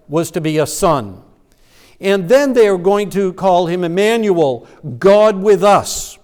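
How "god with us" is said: normal talking voice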